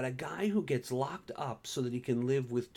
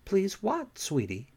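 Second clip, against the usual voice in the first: innocent; 7 semitones higher, 4 dB louder than usual. A narrator's reading. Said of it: "Pam asked innocently".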